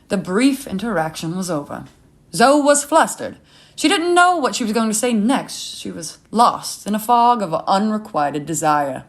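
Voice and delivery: ordinary delivery, nothing marked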